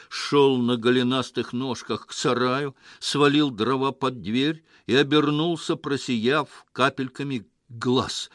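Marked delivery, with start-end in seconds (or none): none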